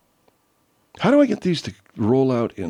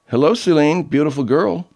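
higher, louder flirty hello; playful come-on, pitched up; flirty greeting; 7 semitones higher, 6 dB louder than usual. Pretend. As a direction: greet her like you're flirting. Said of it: "don't mean to flirt with her".

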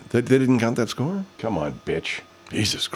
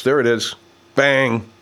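usual speaking voice

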